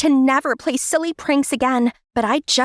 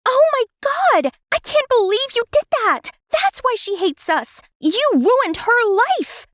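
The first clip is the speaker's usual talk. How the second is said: shocked outburst; 11 semitones higher than usual. Acted. Daughter shocked by mother's confession.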